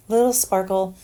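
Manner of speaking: a cutesy lifted "Little sparkle here and there"; playful sing-song. Pretend. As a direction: say "Little sparkle" brightly after the whisper.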